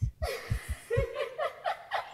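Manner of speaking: high, loud mocking laugh; mocking laughter. Laughter taunting the panicking narrator, high and loud. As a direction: let out a shrill burst of jeering laughter.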